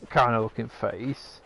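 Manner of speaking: ordinary talk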